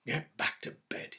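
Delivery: shaky, unvoiced whisper; unsteady. Husband tells his wife to bed, whispered and shaky. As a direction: say it with a trembling breath. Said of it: "he said unsteadily".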